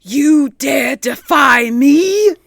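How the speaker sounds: slow, loud menacing spirit; angry spirit. Acted. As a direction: threaten with slow fury. Spirit angered.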